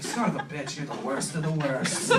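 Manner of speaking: low, soft cursing; muttered grudge. Acted. Fed-up man to his bragging friend, low mock grumble. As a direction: curse him under your breath.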